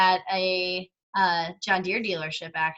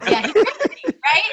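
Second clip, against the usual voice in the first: laughing it out, high and loud; real amused laugh through words; 6 semitones higher, 6 dB louder than usual. Real, not acted. Jokes husband tricked her.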